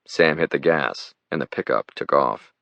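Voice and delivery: usual speaking voice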